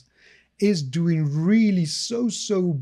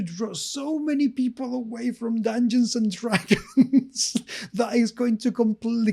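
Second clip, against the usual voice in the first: laughing; 7 semitones higher than usual. Real, not acted. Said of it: "I can't stop laughing right now".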